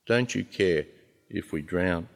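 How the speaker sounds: understated, quiet accusing question; accusation. Acted. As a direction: repeat the accusation softly and deliberately. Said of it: "it's a kind of accusation".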